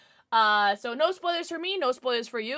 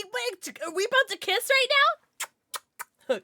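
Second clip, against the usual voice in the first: ordinary talk, nothing marked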